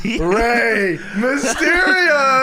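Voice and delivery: high, drawn-out ring-announcer shout; playful high-pitched wrestling hype; mock entrance hype. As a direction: stretch the wrestler's name into a hyped-up yell. Hyped mock ring entrance call.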